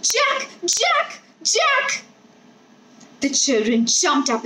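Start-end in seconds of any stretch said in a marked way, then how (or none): 0.0-2.0 high calling to a lost dog. A narrator's reading. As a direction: call the dog from across the yard. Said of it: "She began calling out".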